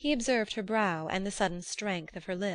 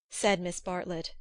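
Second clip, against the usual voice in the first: plain reading voice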